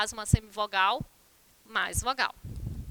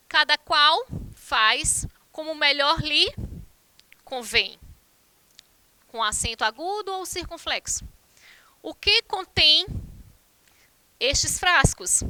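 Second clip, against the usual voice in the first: slow dictation; 4 dB louder, slower than usual. Shown as a demonstration. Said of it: "reads sentences as dictation".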